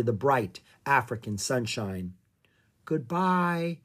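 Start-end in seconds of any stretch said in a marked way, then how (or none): none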